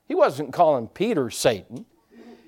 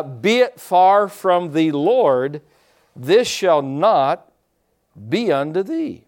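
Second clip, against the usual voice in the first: slow solemn scripture reading, stretched words; King James reading; 2 semitones higher, 4 dB louder, slower than usual. Retold or imitated. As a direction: read the old King James slowly and grandly. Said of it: "reading out the old King James".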